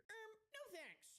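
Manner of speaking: very high, silly "no thanks"; mock refusal. Commentator rejecting the man's rationalizing, mock refusal. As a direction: squeal a comic, put-on refusal.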